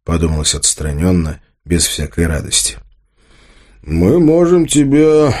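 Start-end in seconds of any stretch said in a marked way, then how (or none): 3.8-5.3 slow, raised threat that breaks off; threatening. A narrator's reading. Tolik starts threatening Arsen and cuts himself off, threatening. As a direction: start a drawn-out threat, then cut yourself short. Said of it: "угрожающе начал Толик и сам же себя оборвал".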